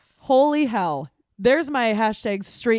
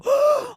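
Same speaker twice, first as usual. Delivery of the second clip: sharp high gasp of fright; startled gasp; 5 dB louder than usual. Retold or imitated. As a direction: gasp like you just saw the mouse. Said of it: "and I immediately go".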